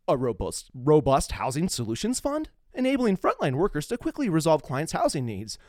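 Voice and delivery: measured, formal read-aloud of a prepared text; formal statement read. Acted. Podcast host reads a charity statement, formal read.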